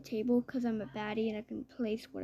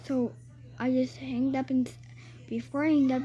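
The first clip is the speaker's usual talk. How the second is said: ordinary talk, nothing marked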